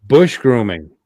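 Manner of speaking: normal talking voice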